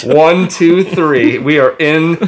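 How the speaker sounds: frantic mock sports commentary. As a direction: call it live like a broadcaster. Mock live broadcast commentary.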